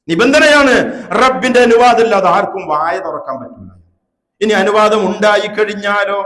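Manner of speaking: forceful preaching. Real, not acted. Preacher sets out the condition of intercession, forceful preaching. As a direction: drive the point home at full volume.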